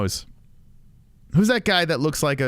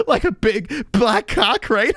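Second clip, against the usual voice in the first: laughing; 8 semitones higher, 4 dB louder, slower than usual. Real, not acted. Mocking joke, laughing.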